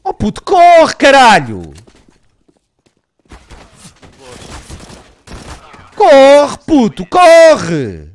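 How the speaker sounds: high, loud yelling at a teammate; furious shout. Real, not acted. Gamer screaming at his teammate to run, furious loud shout. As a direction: bellow at him to run.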